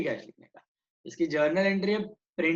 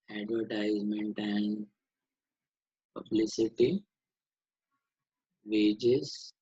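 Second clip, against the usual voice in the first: low, measured dictation with long pauses; teacher dictating headings, pitched down; slow dictation; 6 semitones lower, much slower than usual. Real, not acted. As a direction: read out list items one at a time, slow and low. Dictates column headings slowly.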